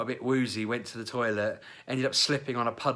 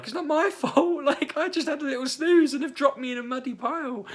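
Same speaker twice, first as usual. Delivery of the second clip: put-on high, loud laughing drunken protest; 7 dB louder than usual. Drunk to wife about the mud, high laughing whine.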